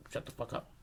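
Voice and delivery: whispered, irritated aside; hushed hiss. Retold or imitated. Boy wanting the waitress to shut up, hushed whisper. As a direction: hiss it under the breath.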